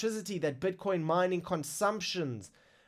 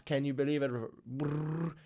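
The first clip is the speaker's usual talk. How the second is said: ordinary talk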